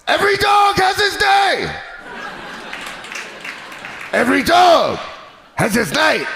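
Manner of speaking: high, slow barking [name] chant. Retold or imitated. [name] boasting to Tyson while training, hoarse shouted chant. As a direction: shout it like [name] hitting the bags. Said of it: "he'd be like".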